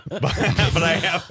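laughing through the words; giggling admission. Real, not acted. Admits getting lost, laughing.

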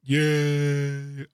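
long, level, unexcited cheer; drawn-out token hooray; half-hearted cheer. Pretend. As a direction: cheer dutifully on request. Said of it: "Can I hear the excitement".

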